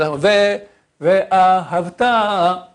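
"ve'ahavta" slow, ve and ta drawn out; little singsong lilt. Shown as a demonstration. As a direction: chant "ve'ahavta" lightly, lingering on the last syllable. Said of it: "Avec une petite chanson".

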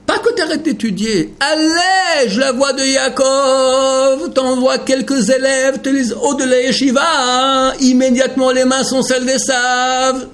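high, drawn-out pleading warning; impassioned warning. Real, not acted. Rabbi warning his students, high impassioned plea. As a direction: plead it, stretching the words. Preacher warns against easing study.

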